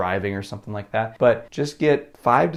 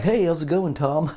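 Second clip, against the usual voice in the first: high, breezy overconfident hello; mock bold greeting; 6 semitones higher than usual. Mock greeting to Tom at the networking meeting, high and breezy. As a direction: ham up a fake-cocky networker. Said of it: "be like".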